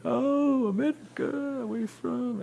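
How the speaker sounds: chirpy, thrilled Dutch cop asking questions; friendly cop thrilled to meet an American; excited cop. Retold or imitated. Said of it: "Just start talking about".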